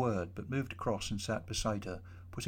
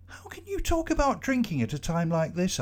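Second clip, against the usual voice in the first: higher-pitched, exasperated challenge; indignant demand; 8 semitones higher, 4 dB louder than usual. Acted. Tom challenges Tony for wanting to drink now, indignant and higher. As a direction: demand it, appalled at the suggestion. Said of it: "I demanded".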